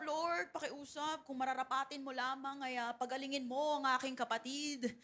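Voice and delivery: mock-timid plea to the Lord; begging. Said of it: "Hindi tayo nakikiusap".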